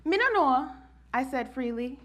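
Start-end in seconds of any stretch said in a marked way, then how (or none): 0.1-0.7 higher, tentative Jamaican "Me no know"; young girl's first Jamaican accent; fledgling Jamaican accent. Retold or imitated. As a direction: try out a Jamaican accent, still new to it. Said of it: "my fledgling Jamaican accent".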